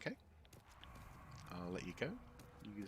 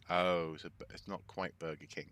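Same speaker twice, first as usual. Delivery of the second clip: usual speaking voice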